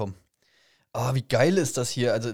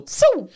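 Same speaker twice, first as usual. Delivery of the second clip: whoosh noise; 6 dB louder than usual. Retold or imitated. Imitating something whizzing past.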